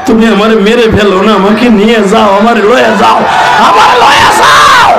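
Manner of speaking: high begging that climbs to a long scream; frantic daughter calling for father; child's desperate pleading. Acted. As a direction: build from urgent plea to piercing cry. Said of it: "দুই হাত বাড়ে আমার ডাকে".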